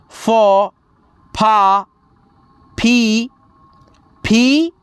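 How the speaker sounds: slow teacher chanting each syllable apart; syllable chart recitation. Shown as a demonstration. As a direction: drill the barakhadi one syllable at a time.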